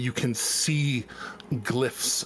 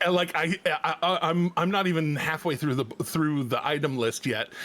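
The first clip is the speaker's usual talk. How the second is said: usual speaking voice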